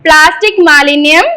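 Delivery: slow, projected recitation in short phrases; leading a pledge. Shown as a demonstration. Teacher leading children in an environment pledge, slow measured call-out. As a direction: call out each phrase firmly, pausing for the echo.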